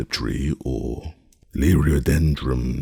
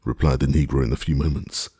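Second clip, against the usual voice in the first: plain reading voice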